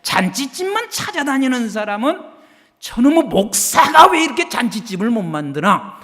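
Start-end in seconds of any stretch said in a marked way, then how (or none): 2.8-6.0 mock grumbling. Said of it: "하면서 목사에게 또 불평하고".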